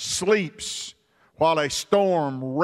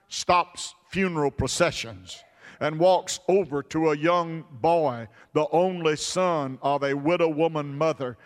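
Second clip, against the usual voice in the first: ordinary delivery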